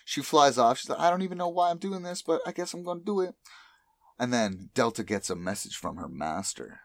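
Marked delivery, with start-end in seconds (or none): 1.0-3.3 high, fast, whiny-style protest; put-on high voice, hurried grumble; reluctant character voice. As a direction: rattle it off high like a grudging helper. Delta retold, reluctantly complying.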